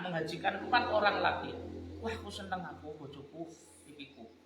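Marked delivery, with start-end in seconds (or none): none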